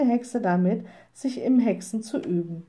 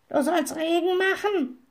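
high-pitched, reproachful raven; scolding bird voice, pitched up; reproachful raven croak; 9 semitones higher than usual. A narrator's reading. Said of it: "krächzte er vorwurfsvoll".

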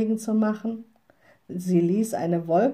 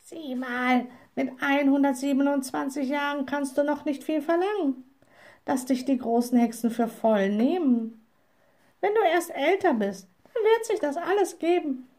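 higher-pitched, consoling bird voice; mildly comforting raven; 6 semitones higher than usual. A narrator's reading. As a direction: soothe the little witch as a lightly voiced raven. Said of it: "zu trösten und sagte".

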